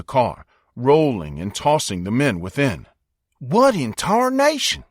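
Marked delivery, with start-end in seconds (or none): 3.4-4.8 high, slow, stretched-out cry of alarm; startled shout. A narrator's reading. Grady yelling in shock as the ground lifts the car. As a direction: yell it as the car starts rolling. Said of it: "shouted Grady".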